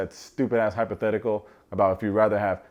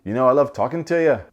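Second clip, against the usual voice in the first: mock Freddie, higher and louder, lifting like a question; imitating Freddie; 4 semitones higher, 7 dB louder than usual. Vlogger mimics Freddie's question-like talk to Catherine, higher and louder. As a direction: do Freddie's eager voice with a questioning lift. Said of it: "everything he says sounds like a question".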